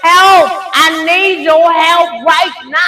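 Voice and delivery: high, loud mock cry for help; loud, high, insistent retold plea; imitated screaming. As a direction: mimic a panicked daughter hollering for mama. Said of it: "She busy screaming".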